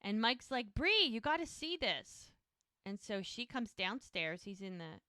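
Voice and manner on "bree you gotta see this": higher-pitched retold call to someone upstairs; mock calling out. Mike calls Bree down to the kitchen, calling out. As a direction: act out calling someone to come see.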